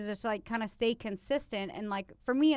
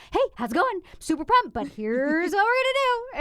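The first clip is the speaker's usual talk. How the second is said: upbeat framing; 7 semitones higher, 4 dB louder than usual. Retold or imitated. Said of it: "I literally frame it like".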